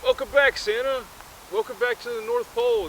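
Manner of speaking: high-pitched welcoming cheer; welcoming elf crowd. Acted. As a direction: greet Santa as a whole crowd would. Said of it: "everyone's like".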